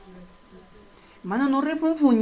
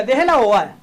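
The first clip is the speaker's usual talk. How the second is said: firm command; 8 dB louder than usual. Shown as a demonstration.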